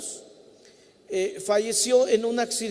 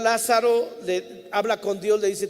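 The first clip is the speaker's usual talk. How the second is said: normal talking voice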